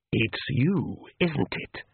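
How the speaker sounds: lightly voiced realising Count. A narrator's reading. Count realizes Agnes's trick.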